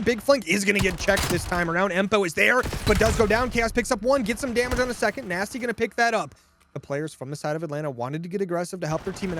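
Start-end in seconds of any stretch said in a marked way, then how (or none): none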